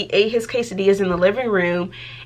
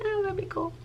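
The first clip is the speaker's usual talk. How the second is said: dreamy wish; 11 semitones higher, 10 dB quieter, faster than usual. Retold or imitated. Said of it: "I was like".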